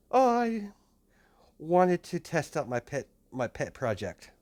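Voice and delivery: high-pitched, put-on character voice, easing down; scheming character voice. Answering Miss Rose about a pet project, high put-on voice. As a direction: start very high on a drawn "Oh, I".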